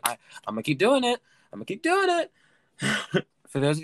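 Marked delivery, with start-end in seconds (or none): 1.5-2.3 very high, louder playful squeal; playful falsetto insistence; high squeaky repeat. Pretend. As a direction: squeak it out far above your usual voice.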